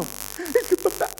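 high, tearful mock-child confession; hammed-up sobbing child voice; crying little girl. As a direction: imitate a small kid bawling out a confession. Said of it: "las tengo a dos enanas llorando".

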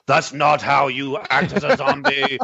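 slow, put-on voice; lightly voiced film director; slow mock director voice. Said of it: "the zombies would come and go".

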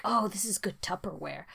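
unvoiced whisper of a tempted shopper; whispered thought. Shopper whispering to herself over a Target dish, whisper. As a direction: whisper it to yourself like a secret.

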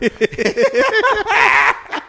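high loud burst of laughter. Real, not acted. Telling friends a favorite scene, laughing hard. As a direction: crack up helplessly.

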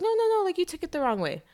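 very high, placating imitation; mock protesting. Host imitating the wife gaslighting her husband, mock protesting. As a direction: backpedal in a high, hurried-to-excuse voice.

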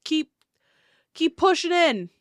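loud high calling voice; man shouting. Acted. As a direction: yell orders to push in. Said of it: "you hear something".

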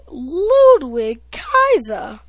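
put-on ring-announce Ludwig Kaiser, slow and grand, in a German accent; announcer's German accent. Kid mimicking the ring announcer's German accent. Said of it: "according to Samantha Irvin".

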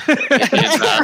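laughing out a word high and loud. Real, not acted. Laughing at the joke.

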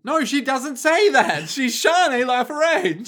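high, loud, slow objection; indignant drawn-out outburst; mock outrage. Mock outrage at co-host's line.

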